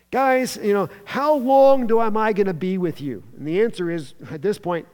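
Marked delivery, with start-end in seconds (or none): 0.1-3.2 raised, louder exasperated appeal; frustrated, sort of angry. Acted. Jesus to his disciples, frustrated and disappointed. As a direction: let disappointed frustration burst out, then ease. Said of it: "Jesus is frustrated. He's disappointed. He's sort of angry".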